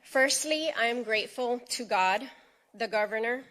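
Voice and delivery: usual speaking voice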